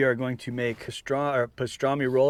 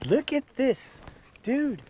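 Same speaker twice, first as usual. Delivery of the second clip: excited; 11 semitones higher, slower than usual. Real, not acted. Showing off the pastrami.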